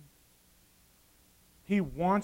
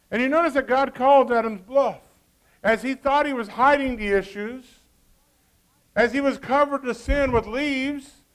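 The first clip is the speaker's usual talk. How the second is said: loud, driving sermon delivery; full-force preaching; 7 semitones higher, 11 dB louder than usual. Acted. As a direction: preach it out to the congregation.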